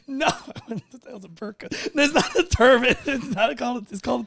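high-pitched, cracking up; helpless laughing objection; laughing protest. Acted. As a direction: try to correct him through laughter. Director cracks up while correcting.

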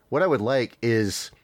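ordinary delivery

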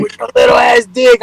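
high, loud mock taunt; imitating his wife. Husband retelling his wife's insults, high mocking shout. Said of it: "She'll be like".

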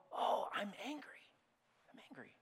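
whispered, noticing the anger calmly; quiet whisper. Shown as a demonstration. Preacher whispering to himself, noticing his anger. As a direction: breathe it out as a hushed aside.

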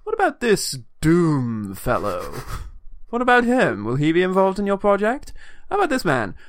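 hammed-up official puzzling over a list; man reading names aloud. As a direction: read it out high and loud, stretching the silly names. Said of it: "when a man looks at a sheet of paper".

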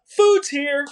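high, loud mock screamed announcement. A narrator's reading. Narrator voicing the sister-in-law at the doorbell, mock scream. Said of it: "she screams".

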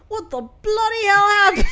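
high, loud mock British shout; imitating his outburst. Friend quoting a film character, in a loud hammed-up British yell. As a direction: ham up his furious yell. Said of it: "he comes out and he's like".

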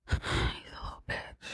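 breathy, barely-there whisper; sigh, then whisper the insult; whispered aside. Real, not acted. Narrator slipping in a jab at a film character, whispered aside.

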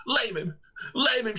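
high mock scream, repeated; screaming girl. Alison urging the dog to curse, screaming. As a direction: shriek it at the dog. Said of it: "she screamed".

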